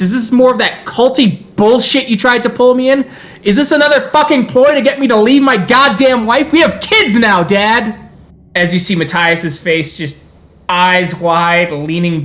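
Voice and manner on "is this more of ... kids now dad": angry son. Acted. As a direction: yell it at Dad, fed up and betrayed. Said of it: "and he goes".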